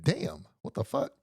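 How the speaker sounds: high, breathy-sounding disbelief; stunned. Retold or imitated. Narrator recalls seeing the doctor land on his knees, high and stunned. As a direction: react like you can't believe your eyes.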